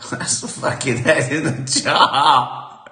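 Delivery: slow, laughing through the words. Real, not acted. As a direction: crack up as you say it. Cracks up at his own idea.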